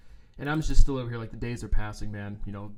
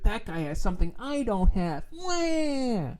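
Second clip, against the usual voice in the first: high mocking whine ending in a wail; put-on petulant whine, shooting up into a fake cry; whiny complainer; 8 semitones higher, 3 dB quieter, slower than usual. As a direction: imitate a crybaby complaining. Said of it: "just like when somebody's like".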